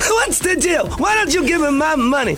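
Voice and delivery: very high, strident stand-up voice; high-pitched comic demand; Chris Rock. Acted. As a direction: hold each phrase high and punch it out. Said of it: "a Chris Rock".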